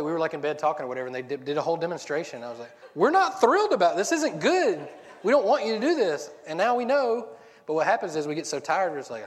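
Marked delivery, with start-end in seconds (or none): 2.9-7.3 much higher, louder mock scolding; exasperated parent. Parent tells kids they are not thrilled, loud mock scold. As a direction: act out scolding the kids, hammed up.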